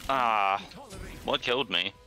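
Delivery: normal talking voice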